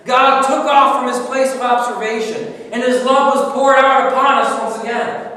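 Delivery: loud, high, soaring sermon voice; full-force preaching. Acted. Preacher pressing God's pain on the congregation, loud. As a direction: preach it out to the whole room.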